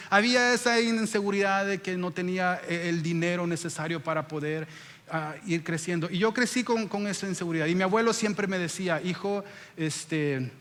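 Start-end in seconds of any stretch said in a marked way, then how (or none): none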